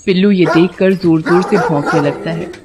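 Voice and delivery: ordinary delivery, nothing marked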